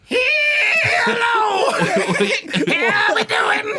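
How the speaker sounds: very high, squealing hello; overly cheery greeter. Retold or imitated. Clerk greeting customers, squealing high cheerful hello. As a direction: greet like a shrieking cashier. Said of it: "she goes".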